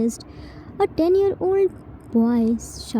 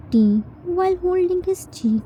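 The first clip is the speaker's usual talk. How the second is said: ordinary delivery, nothing marked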